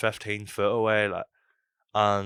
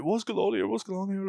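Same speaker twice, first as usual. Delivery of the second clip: high-pitched bewildered protest; alarmed protest; 12 semitones higher than usual. Acted. Teenager surrounded by bouncers, high alarmed protest. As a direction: repeat it high, as a confused kid protesting.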